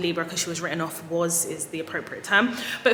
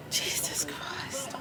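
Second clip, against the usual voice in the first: whisper; 3 semitones lower, 9 dB quieter, much slower than usual. Real, not acted. Overcome at seeing her car.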